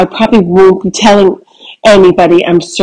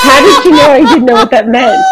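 laughing; 6 semitones higher, faster than usual. Real, not acted. Laughing disbelief.

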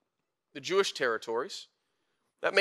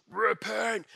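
mock heavy doom message, pitched up; crazy firebrand preacher; 4 semitones higher than usual. Preacher mocking the firebrand image of John, heavy and wild. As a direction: growl it like a caricatured wild prophet. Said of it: "this heavy message".

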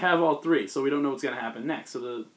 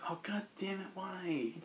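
dismayed groan; 5 semitones higher, 12 dB quieter, slower than usual. Retold or imitated. Peter dismayed, Ben picks Superior.

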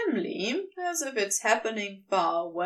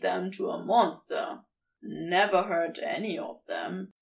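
faintly hurt Crowley; 6 semitones lower than usual. Acted. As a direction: lower voice, a touch of hurt. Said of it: "Crowley sounds faintly hurt".

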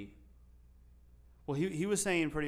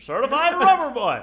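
absurdly high, loud put-on voice; mismatched, over-the-top shout; nonsensical tone; 14 semitones higher, 16 dB louder than usual. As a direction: belt it out in a silly high voice. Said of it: "Say it in a tone that doesn't make sense".

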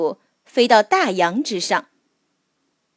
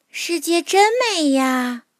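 little mole voice; 6 semitones higher than usual. A narrator's reading. Mole admires the moonlight.

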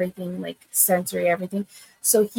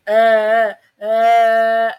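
louder repeated uh uh grunts copying her son; mother imitating her son's nighttime vocal stim; 6 dB louder than usual. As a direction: mimic a child's vocal stim sounds, louder. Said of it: "Vocal, um, stem?".

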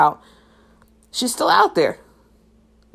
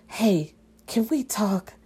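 slow, soft, mock sitcom sincerity; sitcom heart-to-heart; 3 semitones higher, 4 dB quieter, slower than usual. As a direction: ask gently like a TV big sister. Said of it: "like, Full House".

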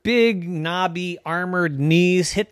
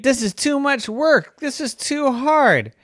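much higher, whining; whiny complaint; 11 semitones higher than usual. Acted. Wanda whines about knighthood.

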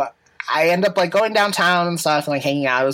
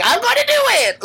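high, loud squeal of defiance; gleeful, pitched-up declaration; defiant squeal; 4 dB louder than usual. Retold or imitated. Defiant shout, laughing.

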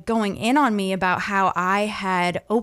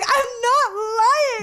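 high, drawn-out mock wail; retold tearful protest; much slower than usual. Crying teen to her disbelieving parents, mock wail. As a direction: stretch it into a hammed-up teenage wail. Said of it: "I was crying".

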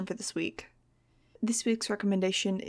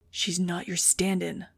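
usual speaking voice

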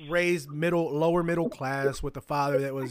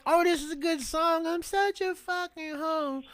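higher, slow mock swoon over a song; mocking fan voice; 12 semitones higher, slower than usual. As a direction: parody a girl gushing over her favourite track.

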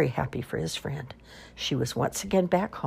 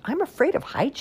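high, frightened little owl voice; small owl confessing fear of heights to Wolf, high and scared; 12 semitones higher than usual. Acted. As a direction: pitch up like a timid baby owl.

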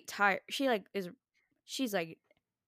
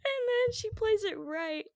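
laughing; 10 semitones higher than usual. Real, not acted. Laughing while telling.

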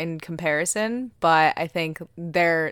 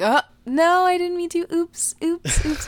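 higher, innocent-sounding oops; mock fake oops; 10 semitones higher than usual. Mocking her fake innocence as the wheelchair tips. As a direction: play a faux-innocent girl caught out. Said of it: "she's like".

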